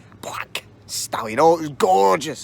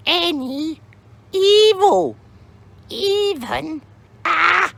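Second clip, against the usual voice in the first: very high, cryptic crone voice; mysterious old lady; 13 semitones higher, 3 dB louder than usual. Acted.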